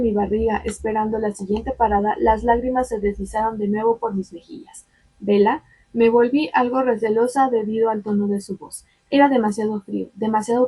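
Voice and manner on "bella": ordinary delivery, nothing marked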